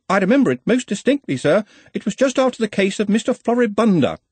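high-pitched, insistent subordinate; earnest, fussy assistant. Acted. Ponder answers Ridcully.

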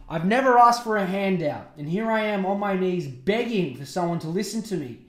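loud, mock-desperate plea; dramatic quote. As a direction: read it out as an impassioned rant. Dramatic reading of her video quotes.

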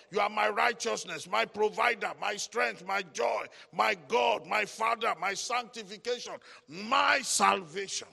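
loud, rhythmic preaching litany; forceful praise declaration. Shown as a demonstration. Preacher showing the church how to praise God, forceful declaration. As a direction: declare each phrase firmly, punching the last word.

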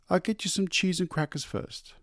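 high, light wife's voice; coaxing wife. A narrator's reading. Wife coaxing husband about supper, high. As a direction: coax him gently upward.

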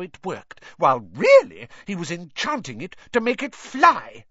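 scolding wife. Acted.

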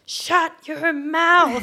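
loud, high, drawn-out yell; retold scream. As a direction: scream it slowly at a harasser, word by word. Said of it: "and I scream".

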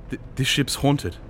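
high, stammering alarm; frightened gasp. A narrator's reading. Thad fearing the ship is haunted, gasping. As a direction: stumble into it, alarmed. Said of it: "he managed to gasp".

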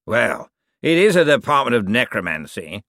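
otherworldly professor's character voice; lightly pompous ghost professor; ghostly necromancer, explaining. A narrator's reading.

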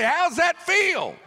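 mock-furious tirade; angry shout. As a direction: shout at the man who gave bad advice.

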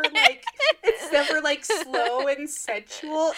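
slow words through laughter; laughing. Real, not acted. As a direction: laugh while drawing it out. Laughing about sensual scenes.

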